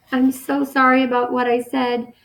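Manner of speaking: sorry through tears, voice lifted; tearful apology. A narrator's reading. Boy crying, apologizing to his mother in bed. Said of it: "I started crying".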